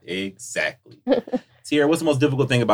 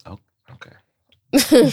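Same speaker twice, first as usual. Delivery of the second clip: drawn-out surprise; 14 semitones higher, 3 dB louder, much slower than usual. Real, not acted. Reacts to "I ain't married.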